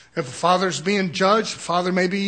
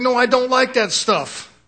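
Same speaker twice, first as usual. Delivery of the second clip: higher, louder, slow and prissy; prim disapproval; 5 semitones higher, 5 dB louder than usual. Retold or imitated. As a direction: draw it out, scandalised. Voicing disapproving church sisters.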